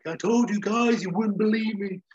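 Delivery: high, loud indignant outburst; I-told-you-so character voice; hammy told-you-so; acting a character. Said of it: "and he's like".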